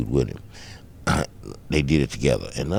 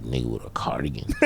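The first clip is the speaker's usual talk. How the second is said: whispered taunt; 9 semitones lower, 9 dB quieter than usual. Retold or imitated. Taunting the dope man.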